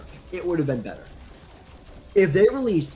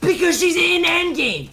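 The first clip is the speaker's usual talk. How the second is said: worked-up outburst; 12 semitones higher, 5 dB louder than usual. Real, not acted. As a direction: shout the obvious ending in frustration. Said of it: "He's, he's so worked up".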